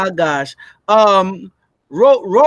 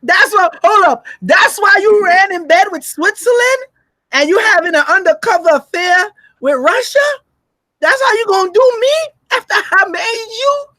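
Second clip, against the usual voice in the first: jealous accusation; 8 semitones higher than usual. Retold or imitated. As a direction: accuse a cheating partner. America voiced accusing a partner.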